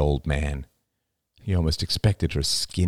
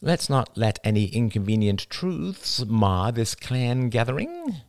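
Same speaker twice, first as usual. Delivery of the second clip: slow, higher sulky sarcasm; 6 semitones higher than usual. A narrator's reading. Jada giving in at the family gathering, slow sulk. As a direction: concede sulkily, dragging it out. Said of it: "said Jada sulkily".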